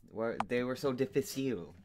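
ordinary talk

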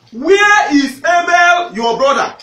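a hint of a stern God, shouted slowly; stern God questioning. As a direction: call out to Cain like a stern judge. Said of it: "Then the Lord said to Cain".

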